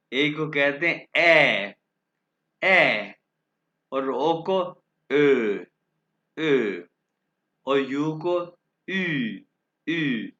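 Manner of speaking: umlaut vowels said slowly and loud, each twice; umlaut sounds. Shown as a demonstration. Teacher showing umlaut sounds to the class: slow and loud. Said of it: "इनको pronounce कैसे करते हैं आप देखें".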